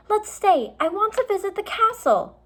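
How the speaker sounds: very high, loud plea; girl begging to stay; eager insisting. A narrator's reading. Annie insisting in quarrel.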